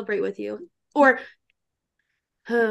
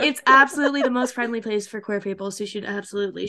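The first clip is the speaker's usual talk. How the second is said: sarcastic; 2 semitones lower than usual. Acted. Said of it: "she says sarcastically".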